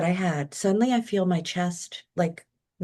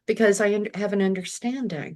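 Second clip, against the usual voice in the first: ordinary delivery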